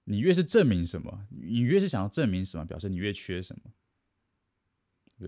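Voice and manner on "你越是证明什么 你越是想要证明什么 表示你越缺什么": normal talking voice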